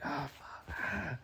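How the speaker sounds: high, breaking groan of pain; pained cry. Retold or imitated. Reacting to his broken ankle, pained cry.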